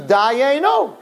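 high-pitched mock outcry; acted scream. Guard reporting what everyone screams to the king, high acted cry. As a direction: cry it out high, like a crowd accusing. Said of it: "And everyone screams".

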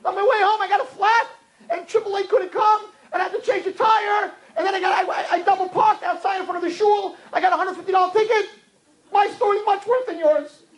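very high, indignant rant; one-upping husband. Acted. Husband outdoing wife's bad day, high-pitched indignant. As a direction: top the other's woes in a hammed-up squeal.

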